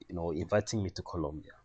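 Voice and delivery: ordinary delivery, nothing marked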